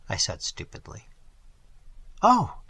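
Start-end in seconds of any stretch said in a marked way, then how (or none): 2.2-2.6 sharp high gasp of an Oh, louder; a short cry with a catch of breath; startled cry. A narrator's reading. Girl hurt by the traveller's remark, startled cry. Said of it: "she cried, with a little catch in her breath".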